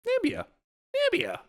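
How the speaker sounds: very high, squeaky mock cry of Nambia; squeaky exasperated Nambia. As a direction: squeal the name in mock frustration. Frustrated repeating mispronounced name.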